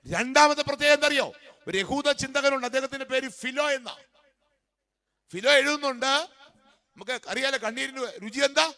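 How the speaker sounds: high, loud revival preacher; ringing, high-pitched exhortation; full-force preaching. Real, not acted. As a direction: proclaim it to the whole congregation.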